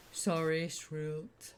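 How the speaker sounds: soft, sheepish apology at the end; meek, quietened reply; chastened underling. Acted. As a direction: shrink back, trailing into a soft voice. Chastened Gem answers Shrilt.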